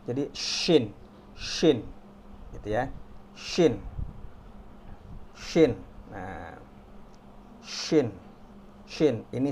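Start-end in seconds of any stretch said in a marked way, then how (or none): 0.3-1.8 "shin" with a long hushing sh; drawn-out sh on "shin"; shooing sh. Shown as a demonstration. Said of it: "kayak lagi ngusir ayam". 3.3-9.2 "Shin" with a strong hushing onset; repeated drill of hissy "Shin"; shooing sh. Shown as a demonstration. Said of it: "kayak lagi ngusir ayam".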